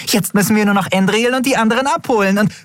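very high, fast, giddy chatter; excited babble. Acted. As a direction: rush on happily in a high little voice. Overjoyed Jadi babbles on until interrupted.

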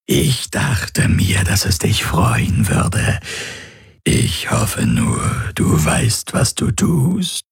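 slow, rasping creature voice; rough, voiceless, drawn-out menace; menacing alien predator. Acted. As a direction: savour each word as a grinning alien hunter.

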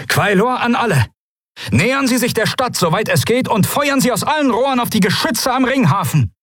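high, urgent commander's call; shouted battle order. A narrator's reading. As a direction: bark orders to the whole fleet.

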